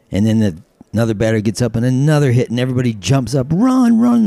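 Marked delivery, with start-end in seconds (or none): 3.5-4.3 high, loud stadium cheer; crowd yelling. Acted. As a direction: shout the runner on.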